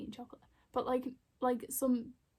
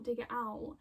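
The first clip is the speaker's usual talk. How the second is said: ordinary delivery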